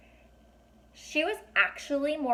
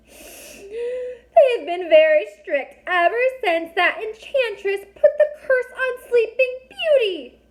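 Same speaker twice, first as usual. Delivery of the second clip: very high, loud, tearful fairy voice; tiny fairy crying as she explains; 14 semitones higher, 11 dB louder, slower than usual. A narrator's reading. Fairy voice held.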